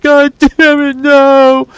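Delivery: high, loud despairing wail; hammed-up sobbing outburst; imitated bawling cry. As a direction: howl it like a gambler who just lost everything. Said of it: "he just starts bawling".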